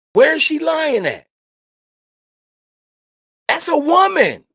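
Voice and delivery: high, loud heated challenge; angry outburst. Real, not acted. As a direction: shout it out in outrage. Heated rant.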